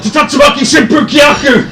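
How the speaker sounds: high, loud martial arts yell; battle cry. Acted. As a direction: scream the move name mid-kick. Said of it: "yells out".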